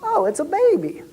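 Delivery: high, innocent mock surprise; fake casual. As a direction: pretend you just noticed. Acting casual walking by.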